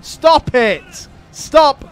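loud, furious ranting at fleeing enemies; angry rant. Real, not acted. Gamer ranting at retreating AI armies, real furious shouting. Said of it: "I wasn't expecting to get such a rant in today".

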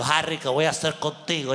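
low, weary scolding mom; exasperated mother. Retold or imitated. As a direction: sigh it like a fed-up mother. Mamá regañando. (madre harta y grave)

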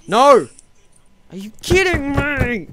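loud high furious outburst; raging gamer. Real, not acted. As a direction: explode at the game. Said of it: "Rage quit".

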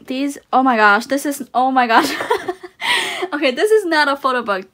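loud laughing excitement; excited laughing. Real, not acted. Postcards found.

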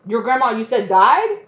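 loud, high incredulous questions; can't believe what they're hearing; the partner staring at the liar, loud and incredulous; stunned disbelief. Retold or imitated. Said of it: "sitting there like".

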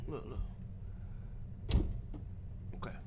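hushed whisper, don't get caught; a real tense whisper. Real, not acted. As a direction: whisper so the monster can't hear. Hiding from monster.

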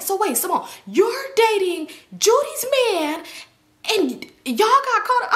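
high, incredulous imitation of a messy boy; mock astonishment. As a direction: play up his scandalized question. Messy boy's nosy question acted out.

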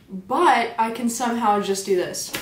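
usual speaking voice

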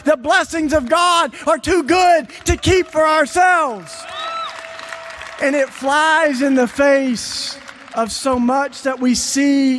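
loud, high, driving sermon voice; a preacher building to a climax; fervent preaching. Acted. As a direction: preach it to the back row. Preaches at rising force.

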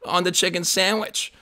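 retold insistent friend. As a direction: insist like a pal in denial. Friend voiced insisting in a story.

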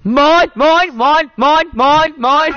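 loud squawking seagull swarm. Pretend. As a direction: call the seagulls down. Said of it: "Swarm of seagulls!".

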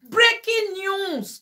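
high, drawn-out news flash; breaking-news announcement. Pretend. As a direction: announce it slowly like a big headline.

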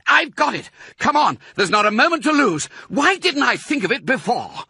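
very high, loud, urgent rush; excited shout. A narrator's reading. Mister Fox rallying his family, excited shout. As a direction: burst out with a sudden idea. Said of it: "He jumped up and shouted".

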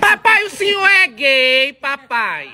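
playful teasing. Acted. Son teasing his father in a slow, high singsong. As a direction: stretch it out to needle dad.

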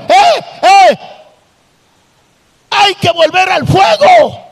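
preaching at full force. Acted. Preacher calling the church back to God's fire, shouting. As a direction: shout the call to return to the fire.

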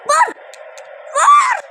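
loud high yell; shouting. Real, not acted. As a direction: scream at him to shoot. Shouting at player to shoot.

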